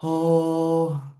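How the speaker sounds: ordinary talk, nothing marked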